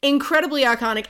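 "Incredibly iconic" said high and loud with a punch on "Incredibly"; emphatic echo. Retold or imitated. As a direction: throw "Incredibly iconic" back with big excitement. Repeats friend's phrase, emphatic.

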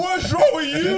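high, loud, disbelieving cry; incredulous outburst. Retold or imitated. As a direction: yell it in shock at his fake accent. Reacting to the singer's accent.

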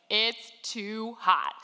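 slow, drawn-out retold complaint; emphatic, weary mock complaint; exasperated. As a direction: quote a fed-up relative, spacing out each word. Sister-in-law's exasperated complaint retold.

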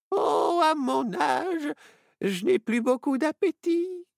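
put-on high, slow, creaky old woman; high-pitched old grandma voice; old lady voice. Old lady speaks in story.

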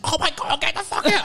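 high startled mock yell at an intruder; high shrieked get out. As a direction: yelp it out like you've been walked in on. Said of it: "and he's like".

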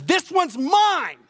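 Jesus telling the Father this one is his; proud claim. Acted. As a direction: claim them as your own, slowly. Jesus claiming the believer before the Father.

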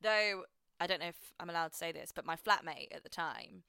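usual speaking voice

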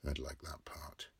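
soft unvoiced whisper; whispered aside. A narrator's reading. Narrator whispers Bloom's wry aside. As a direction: slip it out under your breath.

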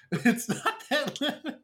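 high, slow laughing. Retold or imitated. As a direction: laugh through it. Laughing mock quote.

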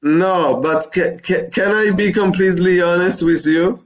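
ordinary delivery

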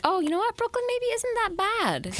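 very high put-on voice; mock reconsidering. Podcaster mimicking blog followers warming to Brooklyn, mock high voice. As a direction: imitate people coming round, pitched right up.

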